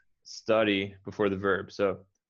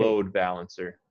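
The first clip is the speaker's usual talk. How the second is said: "Load" clearly stronger than "balancer"; stress on load; 2 semitones lower than usual. Shown as a demonstration. Teacher repeating the model, stressing "Load" again. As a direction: give "Load" a little extra weight. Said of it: "emphasize the word load a little more".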